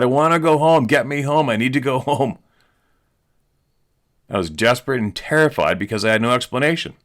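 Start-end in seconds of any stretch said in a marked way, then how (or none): 0.0-2.4 high, loud plea to leave; panicked pleading. Retold or imitated. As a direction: beg frantically to be taken home. Retold friend begging to go home.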